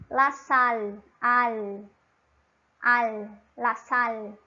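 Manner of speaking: "al" slowly with the tongue touching behind the upper teeth; clear Spanish L. Shown as a demonstration. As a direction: stretch "al, la sal", holding a crisp L. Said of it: "Como é que a gente faz esse som?".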